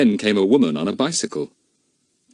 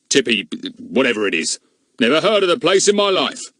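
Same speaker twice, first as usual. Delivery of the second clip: gruff policeman; 5 semitones higher, 3 dB louder than usual. A narrator's reading. Goon reads suspiciously.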